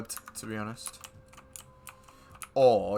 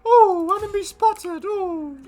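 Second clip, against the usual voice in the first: much higher, slow, sing-song mocking coo; silly high voice; 11 dB louder than usual. Gamer teasing friend about playing with James, high mocking coo. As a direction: coo it like a tease winding up a mate.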